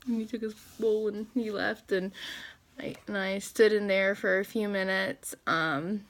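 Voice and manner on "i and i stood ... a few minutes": ordinary talk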